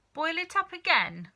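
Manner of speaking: high, loud order; cried out. A narrator's reading. Mister Cranky ordering George, loud high cry. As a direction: call out the order eagerly. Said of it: "cried Mister Cranky".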